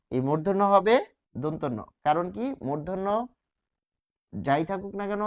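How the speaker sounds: ordinary talk, nothing marked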